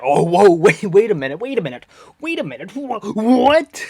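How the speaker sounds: amazed wait what. Real, not acted. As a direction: stop everyone, you can't believe it. Reacting to everyone being variants.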